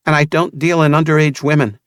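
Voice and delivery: put-on a touch firmer young woman; Vanessa, firm. A narrator's reading. Vanessa voiced by narrator.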